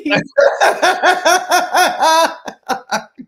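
high, loud burst of laughter. Real, not acted. Partner cracking up at the memory, loud high laughter. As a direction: let a big laugh roll out.

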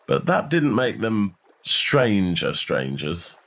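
ordinary delivery

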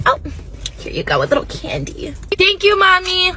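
ordinary talk, nothing marked

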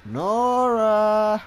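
long, high, held call; taunting drawn-out hail. Pretend. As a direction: call the name out, stretching it. Calling out to hunted game character.

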